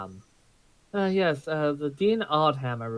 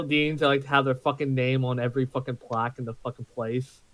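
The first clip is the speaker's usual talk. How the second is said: ordinary talk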